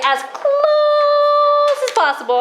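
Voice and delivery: high, slow "As close as possible"; slow model phrase. Shown as a demonstration. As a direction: say it slowly for kids to repeat. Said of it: "let's teach Ben how we say that".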